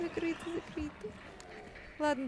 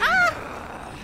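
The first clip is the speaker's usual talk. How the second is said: sudden high shriek; startled scream; 8 dB louder than usual. Real, not acted. Jump scare in horror game. (испуганный крик)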